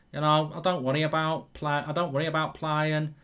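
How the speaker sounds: put-on Brummie accent, a bit Scouse; Birmingham accent. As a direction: do Jack Grealish in a broad Birmingham accent. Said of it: "I didn't sound Birmingham".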